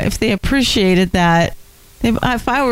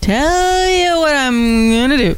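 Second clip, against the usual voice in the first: slow, higher echo of a stump speech; Obama; 4 semitones higher, slower than usual. Retold or imitated. Co-host joining in the campaign-trail impression, drawn-out parody. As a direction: draw it out in mock campaign oratory. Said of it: "When he talked like this?".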